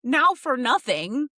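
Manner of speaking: high, loud outrage; real indignant outburst. Real, not acted. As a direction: cry out in protest on a friend's behalf. Friend outraged on guest's behalf.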